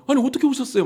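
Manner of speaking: high, loud, startled exclamation at unexpected visitors; startled surprise. Retold or imitated. Preacher retelling greeting Buddhist visitors at church, startled high cry. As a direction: gasp it out, amazed they came. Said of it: "막 제가 오히려 막 놀래가지고".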